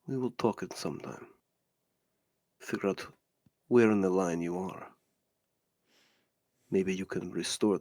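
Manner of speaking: low, hushed, deliberate villain; veiled warning in a low, quiet voice; menacing stranger, appraising. Acted. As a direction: speak softly in broken, weighty phrases.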